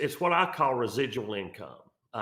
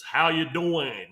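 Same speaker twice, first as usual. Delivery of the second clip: a slow, drawn-out attempt at a New York "How you doing?"; lightly put-on New York greeting, knowing it's not quite there; New York accent; 4 semitones higher, 4 dB louder, slower than usual. Host jokingly greets listeners in a mock New York accent. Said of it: "I don't have the New York accent".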